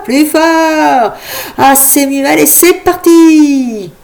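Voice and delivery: high, loud hyped-up showman calling to a crowd; loud, high crowd-pumping host; rowdy party host. Acted. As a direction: whip up the crowd like a fairground host. Imagined loud party host shouting.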